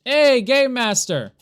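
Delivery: loud drawn-out call to the game master. Real, not acted. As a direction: shout it out slowly, demanding attention.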